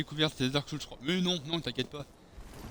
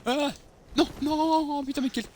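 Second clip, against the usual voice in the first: much higher, louder alarmed no; panicked cry; 12 semitones higher, 5 dB louder than usual. Real, not acted. Sudden danger in game.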